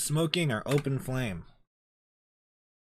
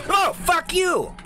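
high, loud, drawn-out scream; startled angry scream; 8 dB louder, slower than usual. Real, not acted. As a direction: scream it in fright. Jump scare.

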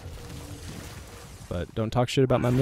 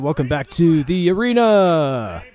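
hype host intro; 6 semitones higher, 7 dB louder, slower than usual. Real, not acted. Opening a video as host.

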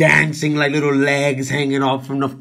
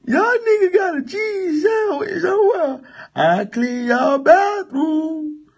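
much higher, whining, pleading; high whiny character voice; 9 semitones higher than usual. Acted. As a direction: beg for a sandwich like a sad servant.

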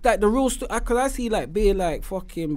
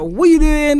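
louder, raised mock challenge; imitated complaint; 6 semitones higher, 5 dB louder, slower than usual. Podcaster imitating lifers on the phone, raised mock complaint. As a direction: imitate an indignant caller. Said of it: "I'm getting phone calls from the lifers".

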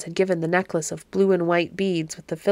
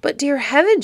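mildly alarmed, higher-pitched exclamation; storybook girl's startled bewilderment; bewildered alarm; 7 semitones higher, 4 dB louder than usual. A narrator's reading. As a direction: exclaim it high, in puzzled dismay. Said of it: "bewilderment and then dull alarm".

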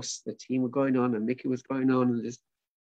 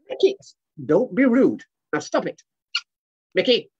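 higher, louder telling-off; scolding; 8 semitones higher, 7 dB louder than usual. Acted. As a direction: tell off a naughty pet firmly. Owner scolds rude monkey.

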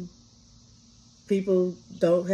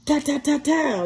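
higher spoken ta-ta-ta like shots; mock gunfire; 7 semitones higher than usual. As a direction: fire off the shots with your mouth. Imitating the judge's gunshots.

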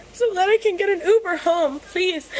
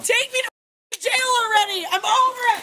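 real high, fed-up scream; distraught, high-pitched defiant outburst; hysterical shouting; 6 semitones higher, faster than usual. Real, not acted. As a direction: yell it in exasperation. Arrested woman yelling at deputies.

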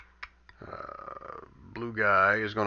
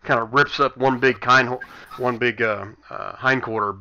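louder, animated combat narration; game master's scene setting; 2 semitones higher, 7 dB louder than usual. Acted. Game master describing a brutal hit to players, animated narration. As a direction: narrate the blow with energetic force.

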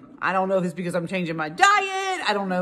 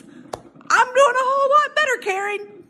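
much higher, mocking singsong; sassy singsong; 3 dB louder than usual. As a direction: taunt her sweetly. Mocking reply to critic.

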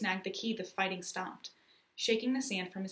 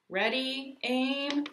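higher, drawn-out military call; shouted command; 6 semitones higher, 4 dB louder, much slower than usual. A narrator's reading. Soldier ordering the cannon crew, shouted command. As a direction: call each order out on a held pitch. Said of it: "the soldier shouted".

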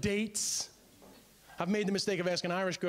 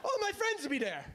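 very high, shouted no, then eager invite; insistent Irish girl; 12 semitones higher than usual. Retold or imitated. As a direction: play the girl pushing the pub plan.

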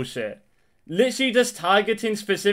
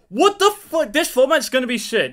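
loud, high burst of outrage; pissed-off outburst; 7 semitones higher, 5 dB louder than usual. Real, not acted. Player condemning the new format, in a pissed-off outburst. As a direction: explode in disbelief, pitch leaping up. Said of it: "I'm actually fucking pissed".

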